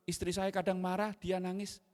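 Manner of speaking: ordinary delivery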